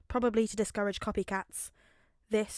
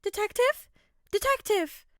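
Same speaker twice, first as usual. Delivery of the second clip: a much higher, louder call over the phone; 11 semitones higher, 7 dB louder than usual. Acted. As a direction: urgently try to get his attention. Nezu calling over the phone.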